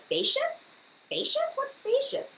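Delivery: high confused FAY-shus misreading; mispronouncing, confused. Retold or imitated. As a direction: squeak Facious as FAY-shus, baffled. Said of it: "I was like".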